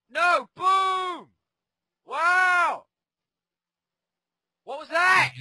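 high loud yelps of shock; real shouting. Real, not acted. Player reacts to a huge hit with high loud yells. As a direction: shout through the burst of hits. Big hit during fight.

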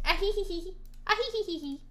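high, silly hee-hee-hee giggle; playful hee-hee. Real, not acted. Streamer who forgot to resize it, silly high tittering. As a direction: titter in high little bursts.

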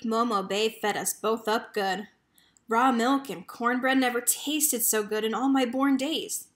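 ordinary delivery, nothing marked

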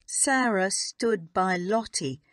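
usual speaking voice

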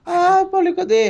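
high, loud frantic calling. Pretend. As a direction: call out, flustered. Frantic calls in game. (chamado agitado, alto e agudo)

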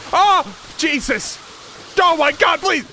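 high loud begging shout; panicked yelling. Real, not acted. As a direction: cry out for mercy under attack. Attacked by goblin.

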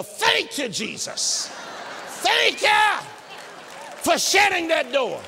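high, loud shout of thanks; joyful shout. Pretend. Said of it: "you want to have a shouting spell".

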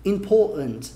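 "important" as a Londoner, "impo'ant"; London glottal stop in "important", R dropped; London accent. Shown as a demonstration. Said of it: "Tem os londrinos aí que falariam".

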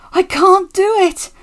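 high, loud, desperate strain; exhausted, high-pitched wail; panting. A narrator's reading. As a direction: cry out as if out of steam. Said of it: "he panted".

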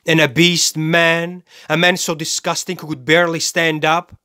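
high-pitched, scornful tirade; indignant contempt. Acted. Killer sneers at his first victim, indignant and scornful. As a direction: pile up the disgust, each phrase pitched high. Rants about victims called innocent.

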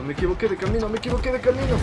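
rising panic. Real, not acted. Wrong path, panic rising.

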